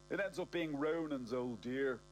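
ordinary delivery

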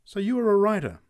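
woman warms to the writer, eager; changed attitude. A narrator's reading. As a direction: switch to eager interest. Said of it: "with a change of attitude".

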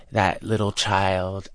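usual speaking voice

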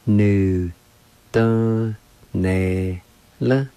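"Nu, dun, ne, la" one syllable at a time; teacher spacing out "Nu, dun, ne, la"; syllable-by-syllable demo. Shown as a demonstration. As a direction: sound out "Nu, dun, ne, la" with a pause after each syllable. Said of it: "It's pronounced like this".

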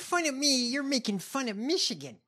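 high-pitched imitation of the candidate; put-on high voice of an aggrieved politician; mocking her voice. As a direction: mimic her complaint up high. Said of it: "I make fun of her voice".

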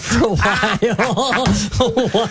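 laughing through the question; giggling while asking, pitch up. Real, not acted. Laughing at a silly comparison.

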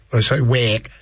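a quick Scouse try at "work", the vowel opened to wack; Scouse accent. Shown as a demonstration. Radio host demoing the Liverpool Scouse accent to listeners, Scouse accent on "work". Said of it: "that's my best Scouse".

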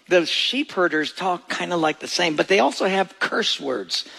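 ordinary talk, nothing marked

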